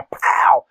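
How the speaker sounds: whispered pow sound effect; mock impact sound. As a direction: spit out a little hit noise. Wife hitting rooster with sunflower.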